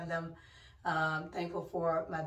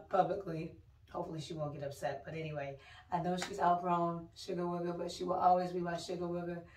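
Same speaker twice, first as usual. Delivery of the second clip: usual speaking voice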